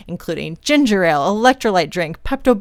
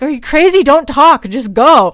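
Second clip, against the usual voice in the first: bossy urging; 8 semitones higher, 8 dB louder than usual. Retold or imitated. Teammate scolding her to keep running.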